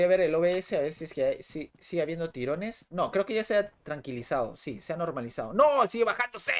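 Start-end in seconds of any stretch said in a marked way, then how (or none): 5.5-6.5 loud high outburst of dismay; frustrated cry. Real, not acted. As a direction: yell at the lagging stream. Stream lagging again, frustrated.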